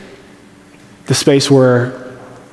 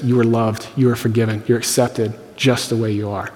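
gentle reassurance; 3 semitones lower, 7 dB quieter than usual. Pretend. The other, loving voice.

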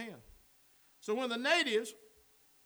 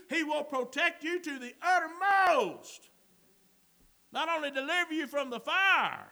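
fervent preaching, punching key words loudly; preaching at full force; 4 semitones higher, 5 dB louder than usual. Real, not acted. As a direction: drive each phrase up to a forceful peak.

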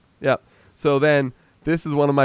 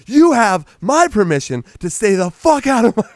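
angry retort; 8 semitones higher, 4 dB louder than usual. Retold or imitated. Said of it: "And he goes".

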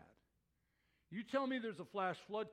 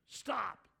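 scoutmaster's halt; 3 semitones higher, 5 dB louder than usual. Retold or imitated. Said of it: "sudden halt issued by our scoutmaster".

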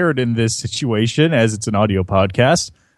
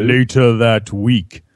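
announcer voice; 3 semitones lower, slower than usual. Acted. Narrator announcer line.

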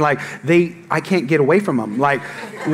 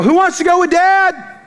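put-on high, slow, sing-song call to the kids; cheery call to the kids; 14 semitones higher, 4 dB louder than usual. Dad calling his kids to come to church, cheery and high. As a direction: call out brightly to the whole house. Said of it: "I always walk out and go".

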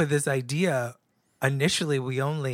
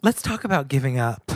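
laughing; 3 semitones higher, 7 dB louder than usual. Real, not acted. Laugh while saying it.

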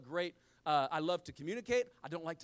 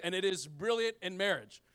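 ordinary talk

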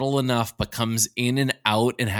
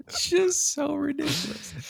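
laughing; 5 dB quieter than usual. Real, not acted. Still laughing at accent.

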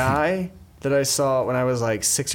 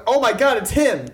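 mock realization; 10 semitones higher, 3 dB louder than usual. Friend mocks expected realization.

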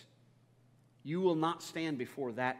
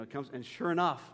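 ordinary talk, nothing marked

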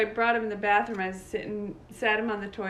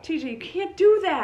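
high, loud protest; re-enacted scolding; 8 semitones higher, 8 dB louder than usual. Grandma correcting grandson after the throw, raised scolding. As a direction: exclaim it as a telling-off.

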